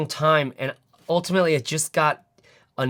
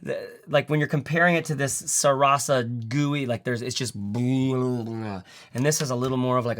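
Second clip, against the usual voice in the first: ordinary delivery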